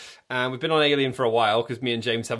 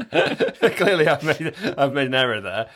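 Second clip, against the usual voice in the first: chuckling through the line; laughing; 6 dB louder than usual. Real, not acted. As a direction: laugh as you admit it. Laughing at notes app mistake.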